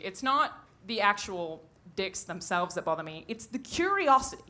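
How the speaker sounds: ordinary talk, nothing marked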